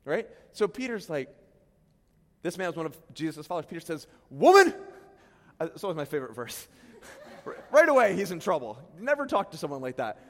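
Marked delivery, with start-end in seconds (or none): 4.3-4.7 harsh retort. Acted. Preacher voicing Peter's harsh retort.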